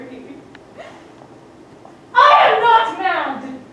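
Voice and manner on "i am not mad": I am not mad! shouted slowly, each word hammered; shouted denial. Acted. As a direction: hurl it at them, slow and loud. Constance insisting she is sane.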